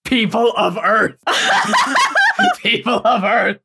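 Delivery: laughing through a hammed-up alien announcement; grand space-overlord greeting, breaking up; cheesy sci-fi alien address with giggles; mock alien proclamation. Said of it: "What if the voice said".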